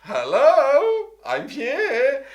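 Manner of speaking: high, loud, sung-out greeting; booming cheery Welsh welcome; big Welsh hello. Pretend. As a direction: announce yourself like the jolly Welsh man. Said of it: "if he was Welsh".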